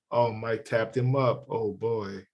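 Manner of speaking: low, hushed mocking onlooker; mock fake friend. Mocking the fake friend who filmed the knockout, hushed. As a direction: murmur it like a bystander enjoying the knockout. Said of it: "Him going".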